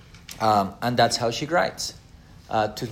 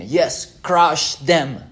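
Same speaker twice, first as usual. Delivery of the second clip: louder, higher hammed-up Luther; mock gruff Luther; 5 semitones higher, 5 dB louder, much slower than usual. Lecturer mimics Luther urging the crush, hammed-up. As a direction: bark it like a keen warmonger. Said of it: "And Luther was saying".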